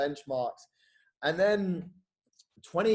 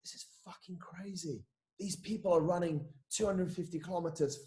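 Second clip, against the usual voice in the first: stunned inner thought; 2 semitones higher, 7 dB quieter than usual. Retold or imitated. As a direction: say it quietly to yourself, amazed. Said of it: "And I was like".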